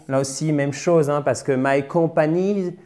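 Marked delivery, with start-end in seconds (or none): 1.6-2.8 "my company" with French-style full vowels and even syllables; French-accented "my company", no strong first syllable; wrong stress. Shown as a demonstration. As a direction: mispronounce "company" as "kom-pa-nee". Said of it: "non, ça marche pas".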